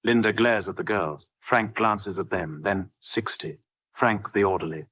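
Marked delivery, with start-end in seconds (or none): none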